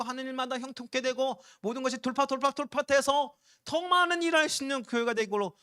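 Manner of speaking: loud, high preaching prayer; fervent prayer. Acted. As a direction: cry out to the Lord for grace. 설교 중 기도를 이어감. (회중 앞에서 주님께 은혜를 구하며 뜨겁게 외치는 기도)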